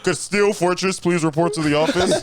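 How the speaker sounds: school announcement voice through laughter; over the intercom. Pretend. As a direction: page him like the front office.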